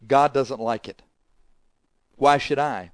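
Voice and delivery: usual speaking voice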